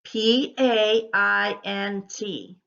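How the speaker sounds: slow, deliberate letter by letter; each letter given its own space; spelling out. Shown as a demonstration. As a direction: spell it out slowly for a class. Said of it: "How would you spell paint".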